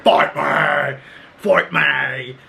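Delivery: mock battle yell, strained and drawn out; Coral. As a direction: relish imitating her yelled challenge. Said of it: "and she's like".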